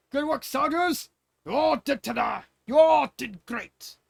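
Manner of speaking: loud, high, slow proclamation; hearty praise. Acted. King praising soldiers after victory, booming and slow. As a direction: boom it out to the troops.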